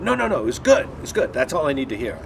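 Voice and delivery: loud, high-starting hurried reassurance; emphatic approval. Retold or imitated. Director cuts actor short with approval, loud and excited. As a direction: imitate a director who has heard enough.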